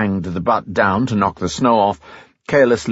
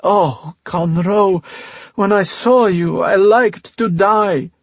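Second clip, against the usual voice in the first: half crying, half laughing; 11 semitones higher, slower than usual. Acted. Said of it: "She was half crying and half laughing".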